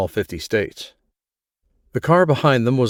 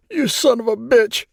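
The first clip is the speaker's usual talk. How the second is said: anguished cry; 13 semitones higher than usual. A narrator's reading. Grieving father cries out at the man he blames. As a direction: hurl it at him, voice cracking high. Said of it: "the man cried".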